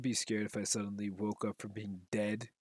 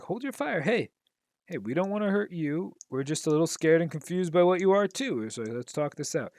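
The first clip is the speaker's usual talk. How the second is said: higher, louder voice, a hero talking a scared man down; Spider-Man calming him; 6 semitones higher, 5 dB louder than usual. Retold or imitated. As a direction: reassure him up high, hands out, hold your fire. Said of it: "and Spider-Man's like".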